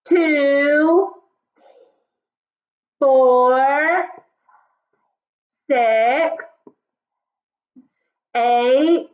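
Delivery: loud, drawn-out count. Shown as a demonstration. Teacher leads class counting out loud. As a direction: call each number out to the class. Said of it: "say the next one loud".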